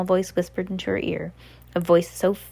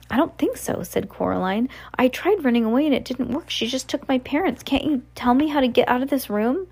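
put-on higher-pitched voice for young Coraline; Coraline, pleading; 6 semitones higher than usual. A narrator's reading. Coraline begs the trapped voices for a way out, higher and urgent.

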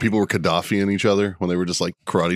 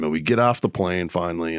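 ordinary talk

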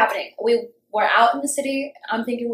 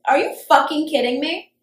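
incredulous anger; 4 semitones higher, 4 dB louder than usual. Retold or imitated. Acted quarrel with boyfriend.

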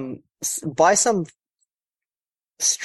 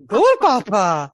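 slow, loud, higher chant of Goal Papa; drawn-out punchline; 7 semitones higher, 7 dB louder, much slower than usual. Acted. Punning the rhyme into football for co-hosts, slow loud chant. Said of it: "talking of goal".

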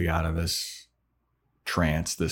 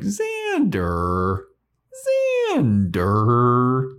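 mock scolding; 6 semitones higher, 5 dB louder, much slower than usual. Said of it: "they're like".

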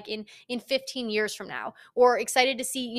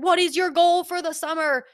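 mock interrogator; 7 semitones higher, 6 dB louder than usual. Mock interrogator asking about goal.

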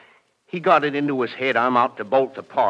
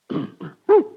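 nervous throat clearing; 7 dB louder than usual. Acted. Nervous dog catcher's habit.

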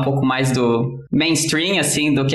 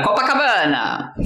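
drawn-out mock shout, no real volume; mock yell; 4 semitones higher than usual. Joke teller voicing first runner crying a destination, mock yell. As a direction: call it out long, falling at the end. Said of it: "sai correndo e grita".

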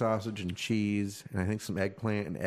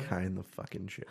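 muttered repeat; 2 semitones lower, 9 dB quieter than usual. Real, not acted. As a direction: mutter it to yourself.

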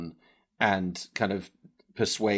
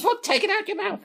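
very high tiny spider voice; squeaky spider; 4 dB louder, faster than usual. Acted. Dick the spider protests, very high and squeaky. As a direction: squeak it out in a hurry. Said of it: "You just hear this from Dick".

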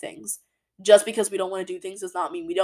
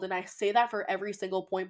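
usual speaking voice

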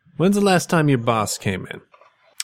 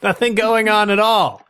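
higher, sing-song denial; fake innocent voice; 8 semitones higher than usual. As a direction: sweetly insist nothing's wrong.

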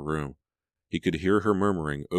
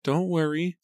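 put-on high-pitched, hard-eyed servant girl; small, high voice with hidden resolve; cold maid; 13 semitones higher, 3 dB louder than usual. A narrator's reading. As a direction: reassure coolly in a high voice. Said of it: "her eyes suddenly cold and hard".

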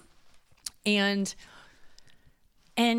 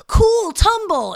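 much higher, louder, slow cheery sing-song; mock cheery babysitter; 14 semitones higher, 10 dB louder, much slower than usual. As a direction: sell the fall as fun to a toddler. Babysitting voice calming fallen kid.

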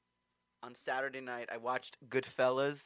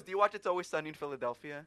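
ordinary talk

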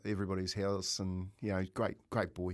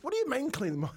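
baffled question; 13 semitones higher, faster than usual. Retold or imitated. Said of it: "And I went".

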